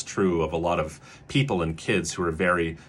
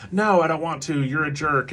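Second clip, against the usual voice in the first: put-on mildly defiant child refusal, pitched up; defiant kid; 7 semitones higher than usual. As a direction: lightly imitate a kid refusing homework. Acting out child refusing homework.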